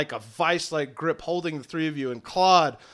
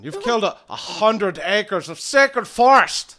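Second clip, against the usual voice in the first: angry rebuke; 5 semitones higher, 8 dB louder than usual. Acted. Druid rebuking trio over sacred forest, angry. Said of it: "who's been extremely pissed off".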